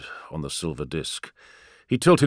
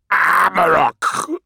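demon voice; 7 semitones higher, 4 dB louder, much slower than usual. Acted. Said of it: "said the demon".

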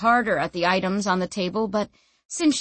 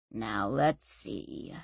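low, slow, quiet mutter; 10 semitones lower, 9 dB quieter, much slower than usual. Acted. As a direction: mutter it to yourself slowly. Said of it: "he muttered".

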